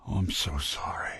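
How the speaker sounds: low, whispery regret; soft, breath-only condolence; hushed, sorrowful apology. Acted. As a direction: barely voice it, stricken with sorrow. Hushed apology after a shocked pause.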